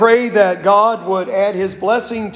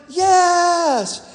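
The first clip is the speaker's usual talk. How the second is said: high-pitched shouted yes; kids shouting yes; 9 semitones higher than usual. Retold or imitated. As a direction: yell it like a whole class at once. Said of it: "And they all shouted".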